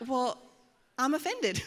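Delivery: telling the congregation, a playful mock-offended. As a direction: play up the offense for laughs. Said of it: "And I just thought".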